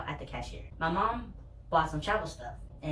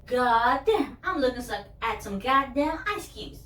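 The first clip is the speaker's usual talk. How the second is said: louder, higher teasing voice; mocking cousin; 7 semitones higher, 5 dB louder, slower than usual. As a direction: drag it out mocking the glasses. Cousin mocks my thick glasses.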